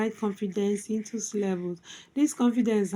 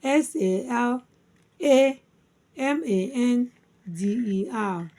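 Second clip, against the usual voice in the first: spelled by syllables. Shown as a demonstration. Teacher spelling salamander for learners, syllable by syllable. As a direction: spell it out with pauses between chunks. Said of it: "Salamander divided into four syllables".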